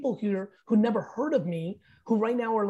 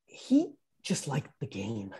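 lower, softer, slow, reflective; quiet realisation; 9 semitones lower, 6 dB quieter, slower than usual. Retold or imitated. Understanding Kobe, low and slow reflection. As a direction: say it softly as a dawning truth. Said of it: "I'm like".

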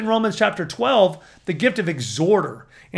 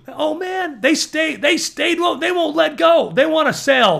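excited outburst; 8 semitones higher than usual. Retold or imitated. Preacher mimicking relentless salesmen, excited near-shout. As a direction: burst out, mimicking marketers who won't let go.

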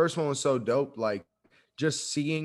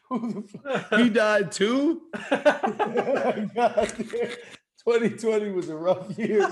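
higher, laughing through words; giggling while talking; 7 semitones higher, 5 dB louder, slower than usual. Real, not acted. Quoting friend while laughing.

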